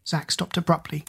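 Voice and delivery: ordinary delivery, nothing marked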